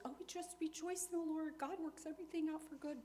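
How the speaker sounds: high, breezy mock-cheerful platitude; glib. Believer brushing off a sufferer, high glib cheer. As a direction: toss it off like an empty comfort. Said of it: "We can glibly tell others".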